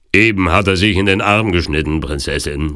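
loud henchman calling out a report; rufender Türke. A narrator's reading. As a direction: start loud, easing off on the last word. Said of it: "rief der Türke". (lauter Ruf des Türken)

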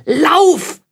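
very high, loud, urgent scream; desperate shout. A narrator's reading. William screaming at the frozen Vogt to flee, desperate shout. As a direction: scream it out at the top of the voice. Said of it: "schrie William noch einmal".

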